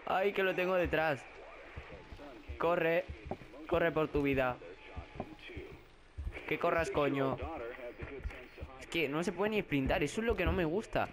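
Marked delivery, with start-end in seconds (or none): 0.1-7.4 panicked yelling. Real, not acted. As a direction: yell in real fright at the chaser. Jugador asustado, perseguido en juego de terror. (gritos de pánico)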